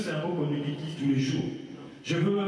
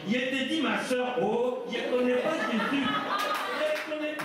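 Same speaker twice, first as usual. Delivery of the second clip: higher-pitched Ivorian accent; accent ivoirien; 6 semitones higher than usual. Shown as a demonstration. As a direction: put on an Ivorian accent, voice lifted. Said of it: "l'accent ivoirien". (accent ivoirien imité, voix aiguë)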